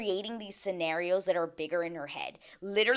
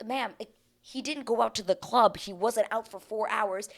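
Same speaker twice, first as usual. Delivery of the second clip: usual speaking voice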